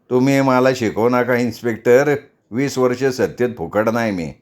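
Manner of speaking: ordinary delivery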